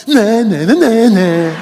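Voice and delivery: put-on loud, teasing child's nah nah; mock the dog like a fearless little girl; mocking taunt. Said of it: "she looked down at the dog and went".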